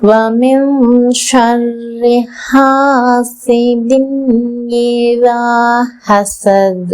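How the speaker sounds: slow, drawn-out Quran chanting; tajweed recitation. Shown as a demonstration. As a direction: stretch every vowel, enunciate each letter. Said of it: "எப்படி தஜ்வீதோட ஓதணும்னு".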